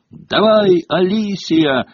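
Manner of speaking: high, ringing shout of encouragement; excited commentator's cheer. A narrator's reading. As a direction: urge the player on from the stands. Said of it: "прервал воцарившуюся на стадионе тишину голос".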